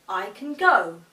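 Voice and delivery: "go" punched loud; "I can go" with a loud final "go"; stress on go. Shown as a demonstration. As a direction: hit "go" hard. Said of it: "We could say".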